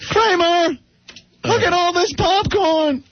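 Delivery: high, incredulous stand-up rant; Seinfeld impression. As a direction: rant about popcorn like Seinfeld. Seinfeld-style bit about popcorn.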